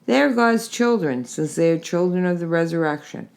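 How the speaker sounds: ordinary delivery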